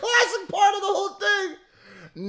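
high, loud, laughing shout of delight; excited laughing shout. Real, not acted. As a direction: yell it out between laughs, thrilled. Reacting to the best part.

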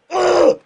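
loud high pained grunt; angry gamer groan; frustrated groan. Real, not acted. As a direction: groan as the shot gets stopped. Shot saved by glove.

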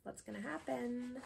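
ordinary delivery

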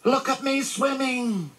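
very high, drawn-out little squeal; thrilled high squeaky voice; squeak. A narrator's reading. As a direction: squeak it proudly like a tiny child. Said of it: "squeaked Roo".

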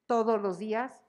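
ordinary delivery

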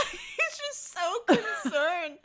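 squeaky high, stretched-out laugh talk; high voice dissolving into giggles. Real, not acted. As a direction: gush slowly through laughter. Laughing fondly at the sad character.

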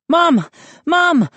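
high, loud calls for mom; boy calling his mother in panic; breathless calling. A narrator's reading. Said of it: "I panted breathlessly".

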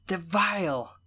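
high, tearful disgust; bitter. A narrator's reading. Evelyn speaks of the creatures while crying, bitter. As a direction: spit it out with bitter loathing. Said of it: "she said bitterly".

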